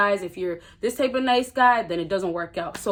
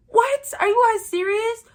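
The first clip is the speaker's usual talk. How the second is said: incredulous; 11 semitones higher, 3 dB louder than usual. Real, not acted. Incredulous at scared men.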